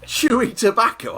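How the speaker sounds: high, slow disbelieving question; can't believe what he heard; incredulous. Real, not acted. Disbelief at chewing tobacco.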